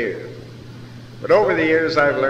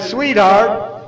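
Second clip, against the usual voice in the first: sweet-talking; 10 semitones higher, 8 dB louder than usual. Retold or imitated. Sweet-talking his wife to help.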